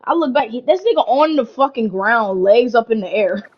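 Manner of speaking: ordinary talk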